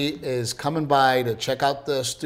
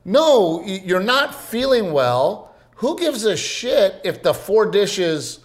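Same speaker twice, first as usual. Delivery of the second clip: retold yell; 6 semitones higher, slower than usual. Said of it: "you didn't need to yell at me".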